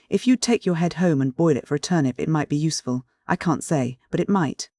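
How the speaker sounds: usual speaking voice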